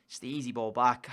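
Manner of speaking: quietly resigned, replaying the error; resigned mutter. Real, not acted.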